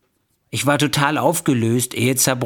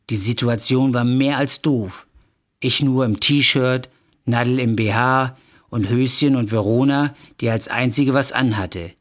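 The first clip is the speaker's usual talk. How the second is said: ordinary delivery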